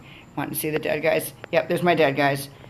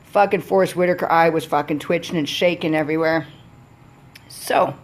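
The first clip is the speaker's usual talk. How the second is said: ordinary talk, nothing marked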